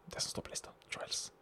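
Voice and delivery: breathy unvoiced whisper of the answer. Host whispering the answer to his co-host, hushed. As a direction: whisper it like a secret.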